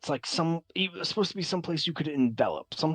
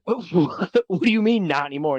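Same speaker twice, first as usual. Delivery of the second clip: louder, higher, fast stammered question; baffled disbelief; 5 semitones higher, 8 dB louder than usual. Acted. Crew questions Winston's lost immortality, baffled and spluttering. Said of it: "shake their heads".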